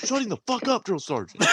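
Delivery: high sing-out dropping at the end; recruit sounding off. Retold or imitated. Recruit repeating order to drill sergeant, high obedient mock shout. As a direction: parrot the order back up high. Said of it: "I was like".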